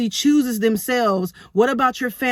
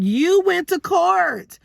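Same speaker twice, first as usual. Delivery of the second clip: retorting; 9 semitones higher, much slower than usual. Retold or imitated. Retorting to ex in retold argument.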